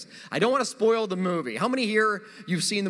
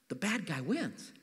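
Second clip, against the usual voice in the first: slow, much softer; slow softer storyteller's hush; hushed reveal; 3 semitones lower, 10 dB quieter, slower than usual. Real, not acted. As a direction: drop it low and let it land. Dramatic reveal of movie ending.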